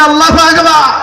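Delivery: shouted takbir. Shown as a demonstration. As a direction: lead the crowd with a big cry. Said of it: "একটু চিল্লায় বলি না".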